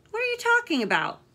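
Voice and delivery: high, loud incredulous protest; outraged at such nonsense. Acted. Characters arguing in book.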